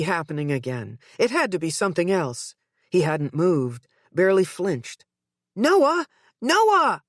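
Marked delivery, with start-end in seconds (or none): 5.5-7.0 loud, high desperate calls; calling out. A narrator's reading. Mother calling her limp son, desperate shout. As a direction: shout his name to wake him.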